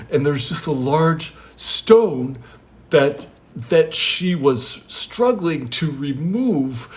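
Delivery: acted, deliberate storytelling; parable recital. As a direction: draw out the key words. Jesus resumes reciting the parable.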